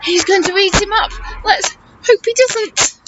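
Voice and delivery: ordinary delivery, nothing marked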